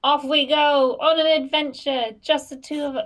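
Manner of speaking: loud, high-pitched cheery send-off; excited. Acted. Bard to companion setting off on an adventure, excited. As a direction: call out eagerly as the adventure begins. Player's character sets off on adventure.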